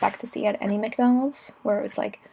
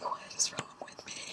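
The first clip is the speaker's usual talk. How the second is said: whispered worry; 13 dB quieter than usual. Retold or imitated. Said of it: "I'm like".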